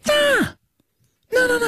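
high squeaky refusal. Pretend. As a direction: squeak the no's way up.

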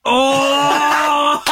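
shocked, then laugh it off; surprised laughing shout. Real, not acted. First feels the shaved face.

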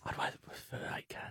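whisper. Real, not acted. Whispered aside after banter.

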